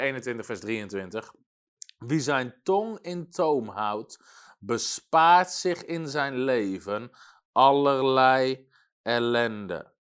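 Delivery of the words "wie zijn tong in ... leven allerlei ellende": slow, weighty verse reading; solemn, drawn-out Bible proclamation; scripture reading. Acted. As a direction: read it out very slowly, louder toward the end. Reads the Bible verse aloud.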